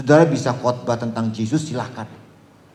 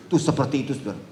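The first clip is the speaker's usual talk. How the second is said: normal talking voice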